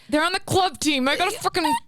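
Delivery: mock indignant retort. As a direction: retell it as an offended protest. Acted retort about club team.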